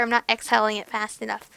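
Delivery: ordinary delivery, nothing marked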